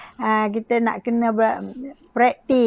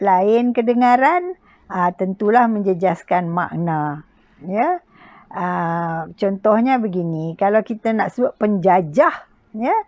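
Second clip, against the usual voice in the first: ordinary talk, nothing marked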